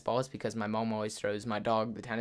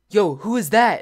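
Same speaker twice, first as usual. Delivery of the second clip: alarmed; 7 semitones higher, 8 dB louder than usual. Retold or imitated. Friends spot axe-wielding figure.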